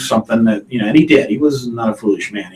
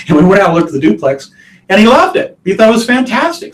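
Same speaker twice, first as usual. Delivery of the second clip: much higher and louder, laughing into it; excited; 10 semitones higher, 5 dB louder than usual. Real, not acted. Agent excitedly recalls the buyer loving the duplex, high and bright with laughter. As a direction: let the thrill of the sale lift you up.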